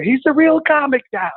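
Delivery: high-pitched imitation of cheering relatives; mock celebration. Aunts tossing him up at Christmas parade, mock-triumphant cry. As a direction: proclaim it high, in hammed-up triumph.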